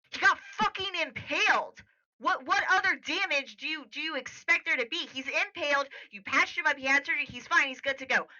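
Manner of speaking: high, fast indignant rant; upset outburst. Acted. Yuri protesting to the doctor about the impaled patient, upset. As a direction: argue heatedly, pitch up, words tumbling out. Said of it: "you're upset".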